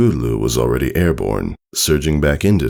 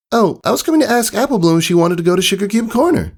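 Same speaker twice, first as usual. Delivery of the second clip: high, fast eager filly voice; faster than usual. Acted. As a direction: rush it out like an excited young pony. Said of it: "Scootaloo replied".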